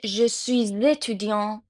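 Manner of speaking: "je suis étudiant" run together, the s of "suis" voiced as z; French liaison. Shown as a demonstration. Teacher explains why the s is pronounced, French liaison. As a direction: join "suis" to "étudiant" through a z. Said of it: "اس ز تلفظ شد".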